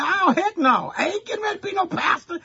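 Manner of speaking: high, fast, incredulous no way; mock refusal. Young preacher to the congregation, refusing the pastor's job, high and fast. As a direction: squeal it out like a young man refusing outright.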